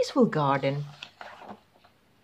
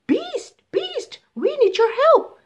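high, urgent pilot voice, louder on the plea; 6 dB louder than usual. Acted. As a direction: call out to the beast in a rush. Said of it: "said the pilot".

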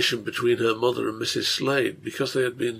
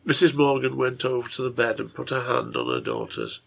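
ordinary delivery, nothing marked

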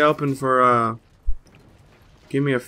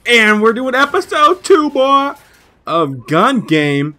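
hype intro; 10 semitones higher, 5 dB louder, slower than usual. Real, not acted.

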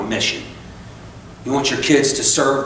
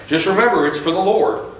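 imitating pious Christians; 5 semitones higher than usual. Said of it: "when Christians that know me say".

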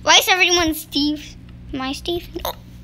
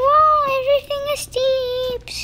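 high, breathy whisper of surprise; 9 semitones higher than usual. Real, not acted. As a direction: whisper it in wonder. Whispered amazement.

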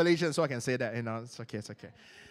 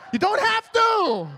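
high, loud, drawn-out exclamation; exclaimed release; 11 semitones higher, 9 dB louder, slower than usual. Real, not acted. Preacher telling friends to drop the act, loud high outburst. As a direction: burst out of the act at full voice. Exclaims after the mock prayer.

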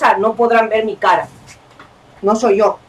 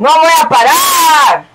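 raised, slow shout with a drawn-out last word; defiant shout; 5 semitones higher, 3 dB louder, slower than usual. Real, not acted. Player vowing not to stop, defiant shout. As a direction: call it out high, stretching the end. Defiant louder repeat of the line.

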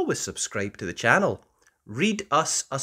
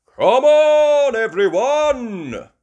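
high, very loud rallying call; rally the whole family; cry out; 12 semitones higher, 12 dB louder, slower than usual. Acted. Daddy Pig calling everyone, loud and high. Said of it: "Daddy Pig cries".

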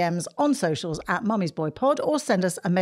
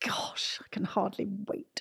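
soft unvoiced whisper; 11 dB quieter than usual. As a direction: whisper back playfully. Mum mimics the whisper.

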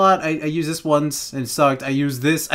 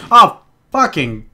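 ordinary talk, nothing marked

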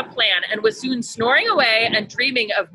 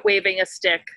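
ordinary delivery, nothing marked

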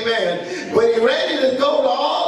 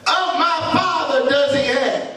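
much higher, pleading, slow; rising anguished preacher's voice; prodigal son's cry; 5 semitones higher than usual. Acted. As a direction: cry out how hungry he is. Son quoting his realisation.

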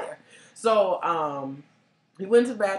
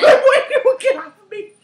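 high, loud laughing telling-off; loud giggly warning; laughing scold; 11 dB louder than usual. Retold or imitated. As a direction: push him away, laughing but firm. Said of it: "I was like".

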